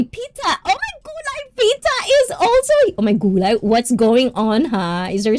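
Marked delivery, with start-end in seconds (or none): 0.0-2.7 excited surprise. Real, not acted. Host spotting another viewer in the chat, excited surprise. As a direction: voice leaping up into a high squeal.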